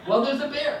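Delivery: high, loud mock protest; exasperated panic. Wife answering husband's why, panicked. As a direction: imitate a frightened wife stating the obvious.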